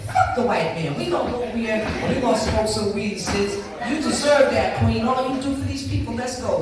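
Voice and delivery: retold friend egging her on. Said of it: "she said".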